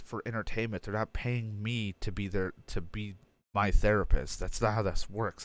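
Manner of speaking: ordinary talk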